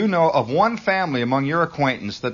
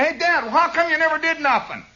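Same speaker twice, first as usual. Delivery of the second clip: put-on very high kid challenging Dad; hollering kid. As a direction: holler the accusation high-pitched. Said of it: "and holler".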